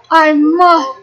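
ordinary delivery

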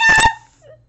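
high, loud "yes"; triumphant yell. Real, not acted. Fan celebrating the chapter, triumphant yell.

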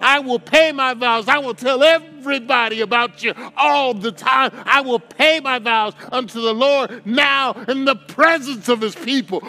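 high, loud preaching climax with a rhythmic cadence; preaching at full force. Acted. Preacher exhorts the congregation at full force, loud and high. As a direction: preach it out, building to a shout.

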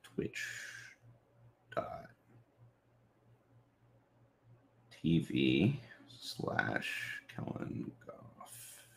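very soft, slow, halting murmur; mumbling while typing. Real, not acted. Streamer typing a post about going live, mumbling to self. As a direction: sound out words as you type them.

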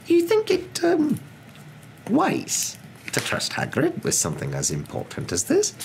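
high, halting, doubtful question; hesitate, then voice the doubt carefully; hesitant doubt. A narrator's reading. McGonagall hesitantly questions trusting Hagrid.

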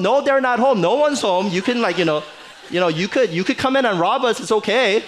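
put-on a carefree little kid inviting strangers in; naive child voice. Child tells strangers at the door, innocently high and loud.

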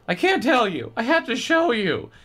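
put-on high boy's voice. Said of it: "he said".

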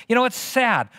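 normal talking voice

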